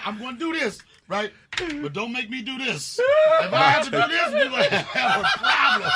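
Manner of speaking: rising into a high laughing warning; mock tough guy, laughing; lightly voiced threat. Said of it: "'Cause he said".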